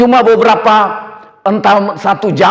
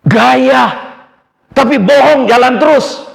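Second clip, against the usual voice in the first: louder, higher mocking outburst; scornful jab; 7 semitones higher, 4 dB louder than usual. Real, not acted. Preacher to congregation, mocking liars loudly. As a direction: scoff at the liars with a loud sneer.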